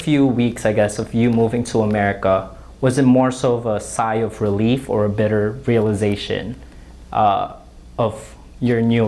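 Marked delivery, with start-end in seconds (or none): none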